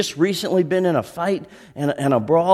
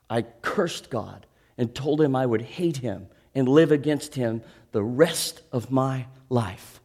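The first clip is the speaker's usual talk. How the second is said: normal talking voice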